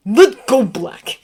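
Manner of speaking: loud angry roar. A narrator's reading. Severus roaring at Sirius to let go, loud. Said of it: "Severus roared".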